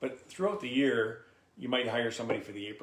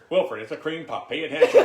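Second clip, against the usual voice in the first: auctioneer coaxing a bidder; 6 semitones higher, 16 dB louder than usual. Shown as a demonstration. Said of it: "If you talk to the people as you're selling".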